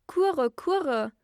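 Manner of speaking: high, loud bird call with falling swoops; storybook dove calling out; cooing wood pigeons. A narrator's reading. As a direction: coo it out like a pigeon. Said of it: "Da sagten die Waldtauben".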